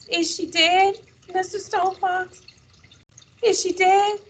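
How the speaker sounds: put-on high, pleading child's question; little boy asking. A narrator's reading. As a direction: ask like an anxious small boy. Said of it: "little Willie asked".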